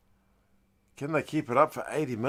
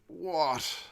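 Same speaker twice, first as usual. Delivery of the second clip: ordinary talk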